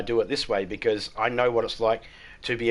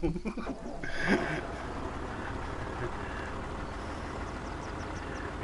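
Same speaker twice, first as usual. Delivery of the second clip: quiet wheezing laugh; 4 semitones higher, 12 dB quieter than usual. Real, not acted. Long quiet laugh after the jab.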